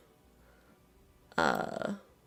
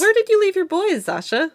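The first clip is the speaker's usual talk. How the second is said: high, loud, fast accusing question; 13 semitones higher, 7 dB louder, faster than usual. Acted. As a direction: demand an answer, pitched up. GM voices an accusing figure.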